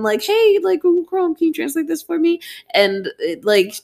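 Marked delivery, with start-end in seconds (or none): 0.2-2.4 high voice asking the phone; talking to phone. Retold or imitated. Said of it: "I keep on trying to do it on my phone".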